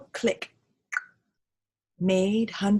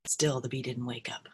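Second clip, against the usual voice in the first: plain reading voice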